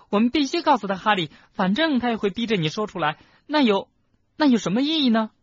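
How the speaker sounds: put-on high, loud, urgent girl's voice; desperate plea. Schoolgirl pleading with her friend to give in, crying out. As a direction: plead at full pitch.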